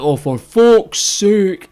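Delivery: exasperated outburst. Retold or imitated. Storyteller imitating Colin's angry outburst. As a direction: drag out the frustration like a short-tempered man. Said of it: "he's kinda renowned for this".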